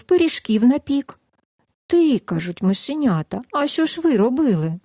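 gentle storyteller voice, lively at the quotes; reading a fairy tale to children. Acted. Narrator reads the tale to toddlers, gentle storytelling. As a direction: tell it like a bedtime tale for little ones.

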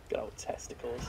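hushed whispered aside; breathy mock-secret whisper. Real, not acted. Muttered joke aside.

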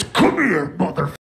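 imitated demonic summons; devil voice. Wife in labor calls husband over, mock devil voice. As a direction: growl it like the devil herself. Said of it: "like the devil herself".

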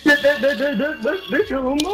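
high mocking echo of his question; mocking high-pitched imitation. Mocking a rival by echoing him in a high voice.

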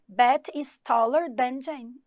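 careful classroom reading; slow model sentence. Shown as a demonstration. Teacher to students, slow model reading. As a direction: read the example slowly for learners. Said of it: "Uma comparação".

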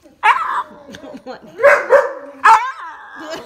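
a loud real burst of laughter. Real, not acted. Owner laughing loudly at her scared dog. Dog freaking out over paw.

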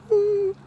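very high eerie ooh; mock ghost wail. Summoning Candyman ghost sound.

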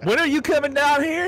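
high, exaggerated country holler; country accent. Joking with friend about being country, high hammed-up holler. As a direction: ham up a country call. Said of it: "about as country as you can get".

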